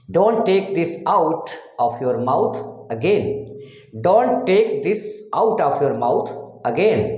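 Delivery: 1 slow demo. Shown as a demonstration. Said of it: "teacher demonstrates English sentence".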